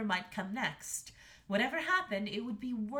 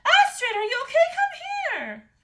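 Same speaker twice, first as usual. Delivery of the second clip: very high, loud frantic mother; frantic queen; 16 dB louder than usual. A narrator's reading. Mother calling the daughter to safety, frantic and high. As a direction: cry out to your child, high and urgent.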